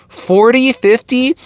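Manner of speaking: a high, unsure guess at a number. Retold or imitated. Guessing the woman's age for the 911 operator, high and unsure.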